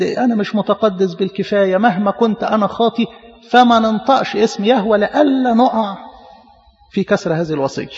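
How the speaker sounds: ordinary talk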